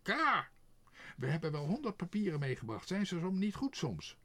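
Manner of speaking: loud, high indignant tirade; outraged crow, squawking and loud; furious. A narrator's reading. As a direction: rant angrily, piling up the complaints. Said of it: "de kraai zei woedend".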